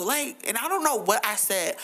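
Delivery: usual speaking voice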